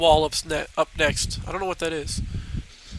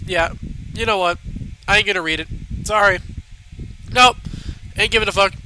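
ordinary talk

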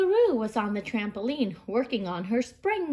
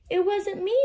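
higher kangaroo voice; 9 semitones higher, 3 dB louder than usual. Acted. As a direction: say it high as the kangaroo. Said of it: "said Kangaroo".

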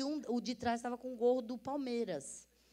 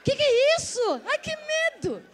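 much higher and louder alarmed cry; startled; 10 dB louder than usual. Real, not acted. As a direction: jump at the strange noise. Startled by studio sound.

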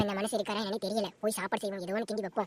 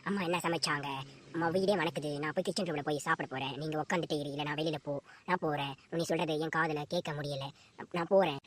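lower-voiced cheeky young girl; playful daughter; 5 semitones lower than usual. Daughter teasing her father about marriage, playful. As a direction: tease her father lightly.

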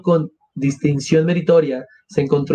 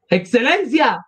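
narrated piercing cry; 8 semitones higher, slower than usual. A narrator's reading. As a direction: cry out suddenly high, holding back the volume. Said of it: "bramó de súbito con un grito desgarrador". (grito agudo y súbito, contenido)

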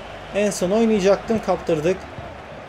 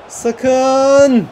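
loud, high, drawn-out "Sakın"; alarmed shout; 5 semitones higher, 5 dB louder than usual. Real, not acted. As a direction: cry out to stop the danger. Alarm as rival is about to score.

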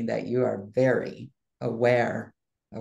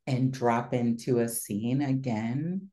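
guided visualization. Acted. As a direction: lead them gently into the scene.

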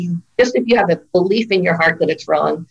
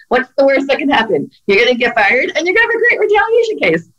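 higher, tongue-in-cheek pep talk; wry advice to employees; 6 semitones higher than usual. Retold or imitated. Lawyer telling her employees, playfully upbeat. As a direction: make getting fired sound like a prize. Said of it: "I always tell my employees".

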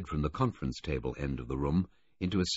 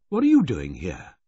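surprised; 2 semitones higher, 8 dB louder than usual. A narrator's reading. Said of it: "Filmer's voice was surprised".

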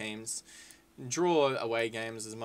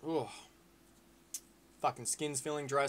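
ordinary talk

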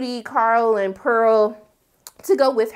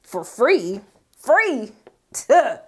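scoffing; much slower than usual. Real, not acted. Scoffing at free work.

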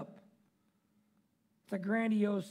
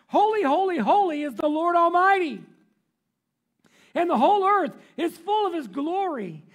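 loud, raised angel cry; seraphim calling out; 6 semitones higher, 9 dB louder than usual. A narrator's reading. Seraphim calling to one another, loud proclamation. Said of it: "they were calling to one another".

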